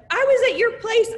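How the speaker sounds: thrilled high-pitched greeting; excited fan. Retold or imitated. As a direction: mimic an eager customer spotting her. Customers recognizing her in store.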